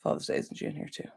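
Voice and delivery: whispered under her breath, reading to herself; murmured chat read. Real, not acted. Streamer reading a viewer's chat comment, whispered. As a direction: mouth the comment quietly as you skim it.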